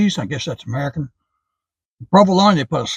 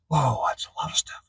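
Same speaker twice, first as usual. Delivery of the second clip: hushed, breathy whisper of awe; 10 dB quieter than usual. Real, not acted. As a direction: whisper it, amazed at the pile. Amazed at the sandwich filling.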